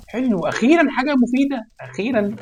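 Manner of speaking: high, bright cheer at finally useful loot; excited relief. Real, not acted. Gamer cheering useful loot, high excited. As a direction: leap up with relief.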